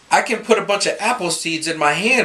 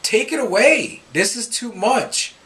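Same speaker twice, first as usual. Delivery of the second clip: higher-pitched plea to the Lord; crying out; 6 semitones higher than usual. Acted. Believers pleading with the Lord, crying out. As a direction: plead upward, mildly desperate. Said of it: "we're crying out to our Lord".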